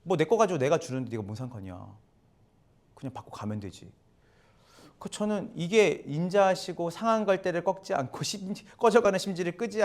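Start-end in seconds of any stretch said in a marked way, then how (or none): 0.0-3.9 퉁명스러운 주인. Retold or imitated. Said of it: "그러니까 이런 거예요".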